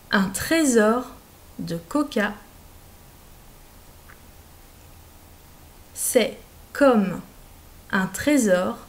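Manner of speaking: slow word-by-word dictation; dictation reading. Shown as a demonstration. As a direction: read out each group clearly for pupils. Dictée lente, mot par mot. (dictée lente et articulée)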